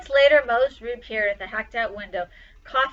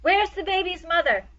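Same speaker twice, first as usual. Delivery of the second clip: raised, loud call over the crowd; shouting hero; 6 semitones higher, 3 dB louder than usual. A narrator's reading. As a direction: call out for the mother above the crowd.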